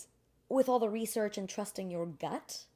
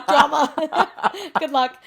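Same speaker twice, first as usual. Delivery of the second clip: loud, high laughing aside; real giggly outburst; 8 semitones higher, 14 dB louder, much slower than usual. Real, not acted. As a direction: laugh through the words. Laughs at own overshare.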